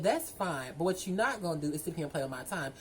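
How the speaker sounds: normal talking voice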